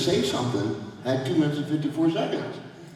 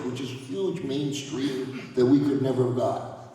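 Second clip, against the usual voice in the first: ordinary delivery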